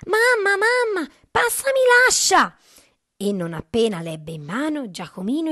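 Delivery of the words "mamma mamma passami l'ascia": very high, loud cry for help; urgent yell. A narrator's reading. Giacomino fleeing the ogre calls his mother, urgent yell. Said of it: "Giacomino urlò alla mamma".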